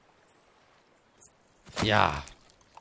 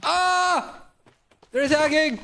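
high, loud alarm; panicked scream; 6 dB louder, much slower than usual. Real, not acted. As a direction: scream the warning. Enemies attack.

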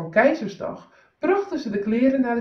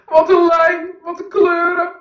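high, loud gushing cries; excited calling out; 9 semitones higher, 9 dB louder than usual. Acted. Peter dances round the emperor, gushing loudly. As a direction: rave about it as if dazzled. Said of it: "danste om de keizer heen en riep".

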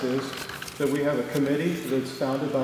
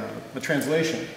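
ordinary delivery

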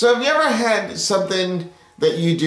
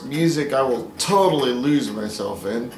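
normal talking voice

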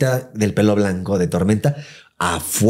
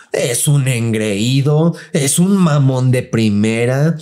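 slow, drawn-out complaining lady; indignant gossip; 3 semitones higher, much slower than usual. Retold or imitated. Scene: housekeeper complains about her boss, slow and drawn out. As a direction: drag out every insult.

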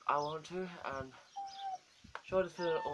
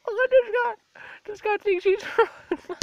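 laughing; 9 dB louder than usual. Real, not acted. Laughing at the cat.

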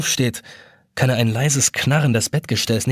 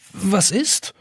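trembling; 9 semitones higher than usual. Acted. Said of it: "fragt er bebend".

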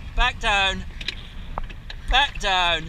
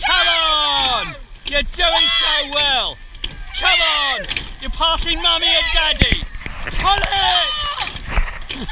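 race coxing calls; 7 semitones higher, slower than usual. Real, not acted. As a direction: push the crew hard, shouting each stroke. Cox calling rowing race.

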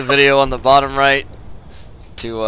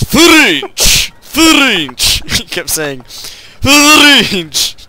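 loud, high mangled "French!" in mock French accent; Gibby's bad French accent; 10 semitones higher, 8 dB louder, much slower than usual. Mocking friend's failed attempt, shouted bad French accent. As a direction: shout "French" with an exaggerated rolled French R. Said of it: "He was trying to speak in a French accent".

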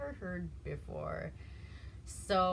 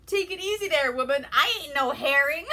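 "Take it easy there, woman" high and loud, fully voiced; Take it easy, in full; 7 semitones higher, 11 dB louder than usual. Jefferson protests the salt to Samantha, high and loud. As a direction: commit to the whole loud high line. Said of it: "Sorry, I can't".

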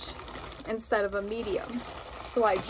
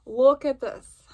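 excited; 4 semitones higher, 8 dB louder than usual. Real, not acted. Excited try-on reveal.

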